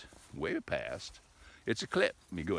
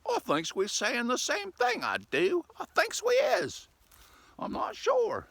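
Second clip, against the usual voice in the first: much higher goofy put-on voice, unsure; silly high cartoon voice; 4 dB louder than usual. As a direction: admit it in a hammed-up cartoon voice.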